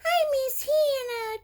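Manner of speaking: high-pitched toy whimper; make-believe tearful complaint; pretend crying. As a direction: play a sad little toy. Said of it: "She go".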